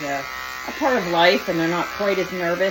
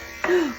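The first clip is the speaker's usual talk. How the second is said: delighted gasp; 10 semitones higher than usual. Real, not acted. Gasp at cute face.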